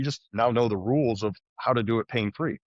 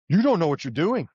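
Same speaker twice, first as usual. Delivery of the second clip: higher and louder, a mock yell; retold yell; 6 semitones higher, 4 dB louder than usual. Patient yelling at his surgeon, mock yell. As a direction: snap it at him like the memory of yelling. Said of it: "I yelled at the guy".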